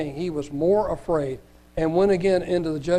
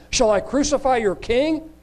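challenging question; 8 semitones higher, 4 dB louder than usual. Acted. Pilate challenges the crowd.

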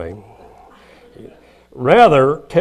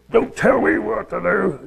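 raised, touch of petulant snapping; husband snapping at his wife over directions, mock angry; mock angry retort; 8 semitones higher than usual. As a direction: bristle back at the backseat driver. Retorting angrily to spouse.

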